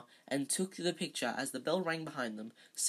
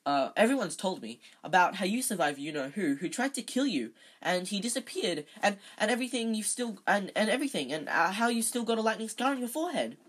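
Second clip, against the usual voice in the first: higher, tumbling fanboy rush; eager; 5 semitones higher, 4 dB louder, faster than usual. A narrator's reading. Starstruck boy tells Harry what he has heard, eager. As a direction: gush eagerly, tripping over the ands. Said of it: "said Colin Creevey eagerly".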